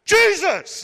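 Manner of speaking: high, loud call for attention; mock frantic shout. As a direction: yell his name to make him turn. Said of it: "I would start to go crazy".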